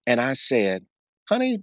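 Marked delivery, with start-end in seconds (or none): 1.3-1.6 higher, impatient lecturing tone; upset tone. Retold or imitated. Retelling how he scolded his tired wife, in a higher, impatient tone. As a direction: talk down to her like she missed the obvious. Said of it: "In that kind of tone, it was all like I was upset".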